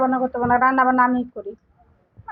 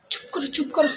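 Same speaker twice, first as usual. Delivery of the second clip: hushed; 3 semitones lower, 7 dB quieter than usual. Acted. Husband hushing wife secretly.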